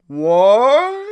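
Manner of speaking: loud, high-pitched giant's bark; booming giant. Acted. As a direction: shout back as a startled giant. Giant answers the warrior.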